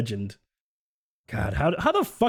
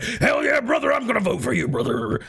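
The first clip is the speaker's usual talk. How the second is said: excited voter; 9 semitones higher than usual. Retold or imitated. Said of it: "And then they're like".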